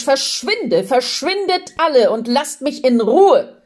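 high, insistent cry; calling out. A narrator's reading. Man ordering the feelings to leave, calling out. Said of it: "ruft der Mensch".

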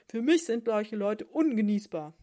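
very loud furious octopus yell; shouting octopus. Acted. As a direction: bellow it in disgust. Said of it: "schrie Karlchen Krake".

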